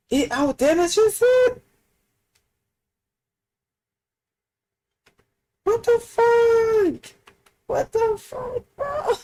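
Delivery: very high, stretched shocked cry; stunned, squeaking in disbelief; amused disbelief. Real, not acted. Shocked again at damage.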